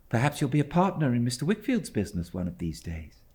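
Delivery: a lightly voiced quick young boy; young David. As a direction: chatter eagerly to please.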